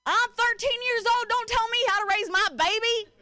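high, indignant country twang; country accent. Acted. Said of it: "like, in a country accent".

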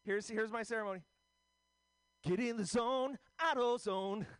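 high-voiced mock stand-up opening; Pancake. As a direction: pitch it way up like an eager comic. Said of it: "Here's my impression of Pancake".

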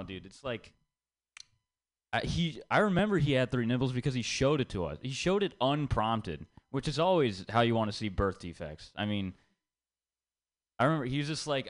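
normal talking voice

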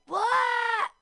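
loud startled yelp of pain; pained shout. Retold or imitated. Stomped on in a mosh pit, she yells in shock. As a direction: yell it out as someone lands on your foot.

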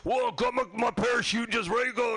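high, breezy mock skydiver; hammed-up carefree adrenaline junkie; mock daredevil. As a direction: toss it off like a cocky jumper. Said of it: "he's the kind of guy who goes".

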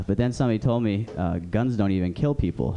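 ordinary talk, nothing marked

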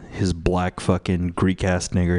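ordinary delivery

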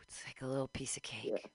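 soft whispered reverie; hushed longing whisper. Real, not acted. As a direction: whisper it like a secret treat. Dreamy whisper about cookies.

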